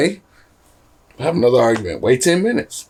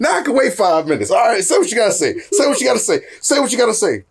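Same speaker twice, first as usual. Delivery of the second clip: laughing; 9 semitones higher than usual. Retold or imitated. Mimicking patient self, laughing.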